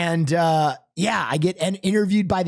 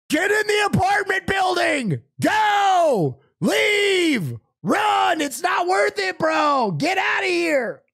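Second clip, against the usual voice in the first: frantic shouted warning to flee; urgent shouting; slower than usual. Retold or imitated. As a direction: yell at them to get inside now. Ordering couple to flee.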